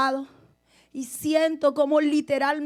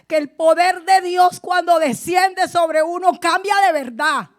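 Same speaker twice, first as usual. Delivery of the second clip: loud fervent preaching, louder throughout; preaching at full force; 3 semitones higher, 8 dB louder than usual. Acted. Pastor insisting to the church it is no show, loud preaching. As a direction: proclaim it over the congregation.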